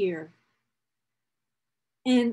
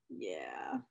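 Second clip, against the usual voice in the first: whispered yeah; breathy whisper; 17 dB quieter than usual. Real, not acted. Looking at photos, a whispered yeah. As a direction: whisper it to yourself.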